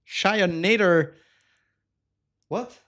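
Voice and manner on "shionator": Shionator stretched slowly with a falling end; a slow, playful repeat of Shionator; drawn-out name. Real, not acted. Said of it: "Thank you for the follow".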